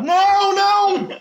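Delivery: very high, loud hammed-up wailing; mock wailing crowd. Church crowd wailing at the funeral, high mock shrieking. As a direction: wail like a sobbing congregation, mock shrieks. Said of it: "toda a igreja começa a chorar".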